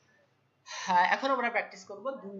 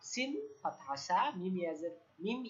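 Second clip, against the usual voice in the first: ordinary delivery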